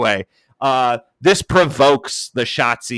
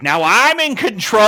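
high, slow, hammed-up proclamation; mock her grand promo line; hammed-up high defiant line; mock dramatic declaration; 10 semitones higher, 5 dB louder than usual. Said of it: "she's like".

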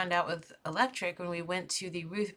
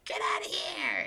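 crow yelling; 11 semitones higher than usual. Retold or imitated. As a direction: screech it high as the crow. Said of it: "as the crows, like".

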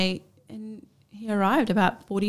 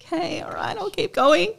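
resigned go-on; 10 semitones higher than usual. Retold or imitated. Giving in to midwife.

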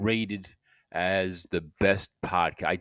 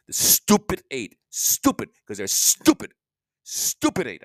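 higher and louder, spitting out stupid; exasperated cursing; 5 semitones higher, 5 dB louder, slower than usual. Real, not acted. Cursing the neighbors at the motel, exasperated and much higher. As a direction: vent the frustration, jabbing each stupid.

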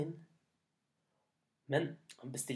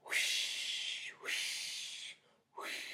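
breathy wind gust whoosh; much slower than usual. Acted. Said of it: "sa Nordavinden".

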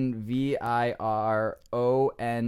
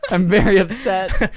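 mock upset; 3 semitones higher, 6 dB louder, slower than usual. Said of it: "Taking my rage out on you".

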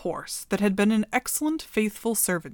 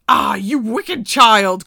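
loud, slow furious shout of the witch; angry witch cry; 11 dB louder, slower than usual. Acted. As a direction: bellow it out at the girl. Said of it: "cried the enchantress".

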